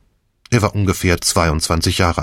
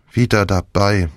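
usual speaking voice

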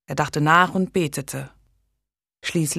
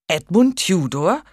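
aghast king, a louder startled question; 3 semitones higher, 5 dB louder than usual. Acted. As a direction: blurt the name in disbelief. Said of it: "fragte Henry entgeistert".

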